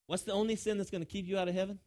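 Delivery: ordinary talk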